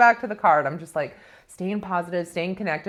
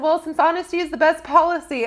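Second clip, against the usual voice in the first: nervous confession; 8 semitones higher, 6 dB louder than usual. Retold or imitated. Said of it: "I'm nervous".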